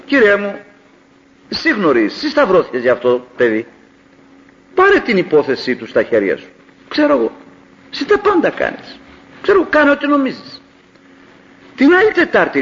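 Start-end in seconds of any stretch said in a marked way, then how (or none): 0.1-10.6 earnest prayer. Retold or imitated. His own prayer retold.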